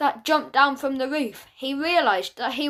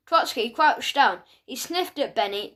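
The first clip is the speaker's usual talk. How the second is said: plain reading voice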